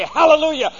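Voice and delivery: normal talking voice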